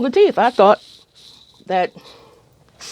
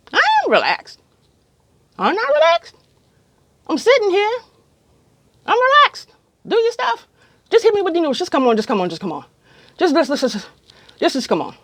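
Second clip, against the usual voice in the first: much higher, rushed and tense; frantic inner plea; 10 semitones higher than usual. Retold or imitated. Anxious patient urging dentist on, high and frantic. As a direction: beg him to just get on with it.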